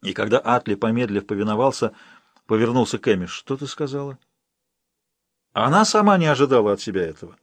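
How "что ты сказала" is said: much softer, hushed disbelief; stunned question. Acted. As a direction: ask it almost under breath. Stunned question after confession.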